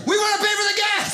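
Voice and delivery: high fast frantic yell; panicked yelling. Retold or imitated. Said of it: "I couldn't stop yelling".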